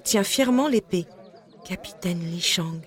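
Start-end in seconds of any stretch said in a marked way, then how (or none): 1.6-2.8 soft, slow murmur; murmuring to himself. A narrator's reading. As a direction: murmur it under your breath. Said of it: "se murmure-t-il à lui-même".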